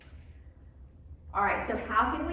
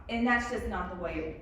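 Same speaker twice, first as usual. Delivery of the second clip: ordinary delivery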